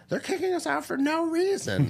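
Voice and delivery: high, whiny put-on woman's voice. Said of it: "the woman's like".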